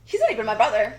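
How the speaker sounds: loud retold protest; insistent denial. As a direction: act out a forceful denial. Retold quarrel over family ties.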